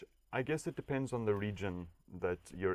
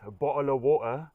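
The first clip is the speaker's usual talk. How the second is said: louder, higher dirty British accent, T's dropped; 5 semitones higher, 7 dB louder than usual. Shown as a demonstration. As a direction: do a rough British accent with glottal stops. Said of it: "dirty British accent".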